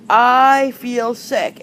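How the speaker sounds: deliberate teacher translation; slow translation. Shown as a demonstration. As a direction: spell out the meaning slowly for learners.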